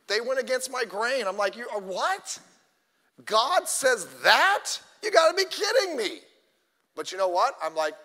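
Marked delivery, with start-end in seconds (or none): 1.6-6.2 rising into an incredulous, louder cry; half-playful stunned outrage; mock disbelief. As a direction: act out being shocked at the rule. Retold shock at God's rule.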